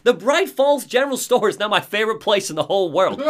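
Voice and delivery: high, excited Barry impression; mock-heroic Barry bragging. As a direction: ham up the cocky survivalist. Barry voiced in game recap.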